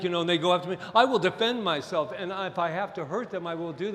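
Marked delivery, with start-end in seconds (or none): 0.9-2.1 forceful. Real, not acted. Preacher declaring self-defence forcefully.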